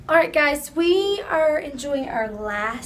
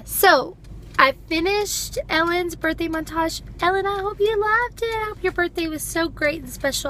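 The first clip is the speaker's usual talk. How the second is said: ordinary talk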